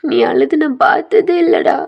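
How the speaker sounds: loud, high, slow anguished pleas; acted cry of distress, drawn out; tearful pleading. A narrator's reading. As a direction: beg a weeping friend to stop, close to crying yourself. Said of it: "அவனும் அழுகுறான்".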